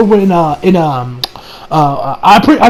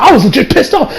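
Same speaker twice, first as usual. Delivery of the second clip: ordinary talk, nothing marked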